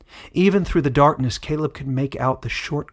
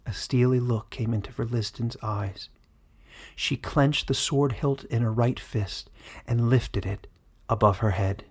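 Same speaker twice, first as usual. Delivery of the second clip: ordinary delivery, nothing marked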